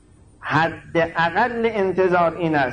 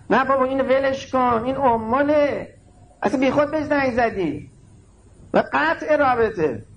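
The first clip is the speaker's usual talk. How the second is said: put-on much higher, dismissive young voice; scornful youth; 9 semitones higher than usual. Preacher voicing youths who scorn worshippers, mocking. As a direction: brush them off like a sneering teenager.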